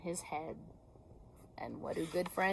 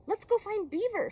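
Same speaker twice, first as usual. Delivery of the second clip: much higher, bright character voice; high eager Rabbit voice; 12 semitones higher, 4 dB louder than usual. Acted. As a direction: pitch way up for the rabbit.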